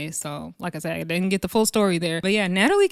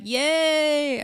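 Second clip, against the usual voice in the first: mock cheer; 7 semitones higher than usual. Retold crowd cheering the singer.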